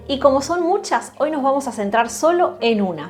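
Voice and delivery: ordinary delivery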